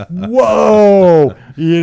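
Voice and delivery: laughing, then a loud high whoa. Retold or imitated. Hearing the champagne cost a hundred dollars, laughing whoa.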